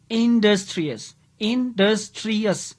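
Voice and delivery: industrious pulled apart slowly into four beats; syllable by syllable. Shown as a demonstration. Teacher showing students four syllables, industrious split beat by beat. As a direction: sound out in, dus, tri, ous in industrious. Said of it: "four syllable".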